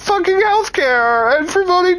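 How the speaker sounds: mock her tears with a fake wail; hammed-up high weepy voice; mock sob voice. Mocking crying caller's family story.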